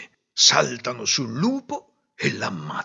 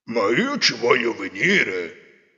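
wolf voice; 3 semitones lower, slower than usual. Acted. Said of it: "disse il lupo".

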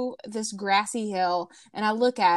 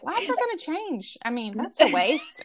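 higher, quicker indignant comeback; exasperated retort; 7 semitones higher than usual. Retold or imitated. To her husband about her pajamas, quick indignant retort. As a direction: fire it back like it's obvious. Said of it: "I was like".

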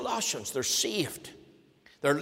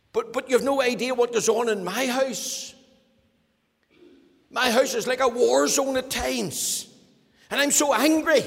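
louder and higher to a near shout on angry; angry complainer; 4 semitones higher, 4 dB louder than usual. Acted. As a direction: build the anger at home. Acted household anger rising.

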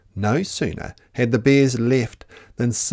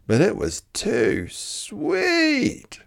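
drawn out; 12 semitones higher, much slower than usual. A narrator's reading. Storyteller stretches the comparison.

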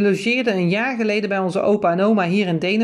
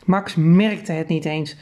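ordinary delivery, nothing marked

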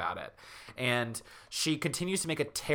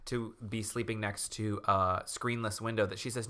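usual speaking voice

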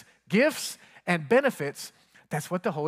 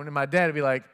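ordinary delivery

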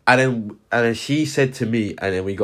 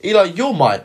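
put-on girl's taunt; 10 semitones higher, 6 dB louder than usual. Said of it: "she's like".

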